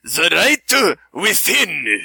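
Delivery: raspy mock Japanese accent; hammy samurai movie voice. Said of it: "Toshiro Mifune voices".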